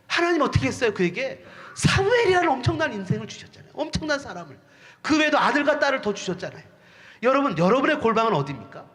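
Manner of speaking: ordinary talk, nothing marked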